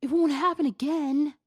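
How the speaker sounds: put-on high, loud whiny boyfriend plea; pleading vow. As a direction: beg her like a guilty boyfriend. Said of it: "Hiba vowed".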